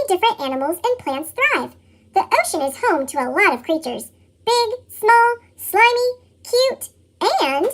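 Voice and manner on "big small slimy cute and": helium voice. Acted. Listing sea creatures playfully.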